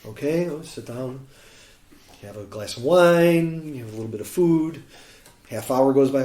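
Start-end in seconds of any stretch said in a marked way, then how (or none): none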